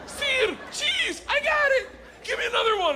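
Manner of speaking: very high, loud eager yelling; eager shout. Retold or imitated. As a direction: shout it like a keen student.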